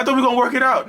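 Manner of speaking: hammed-up disbelief; hurt victim. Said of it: "You're like".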